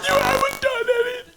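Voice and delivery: very high weepy mock wife; mock crying wife. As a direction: whine it like a teary wife. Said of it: "the woman's crying".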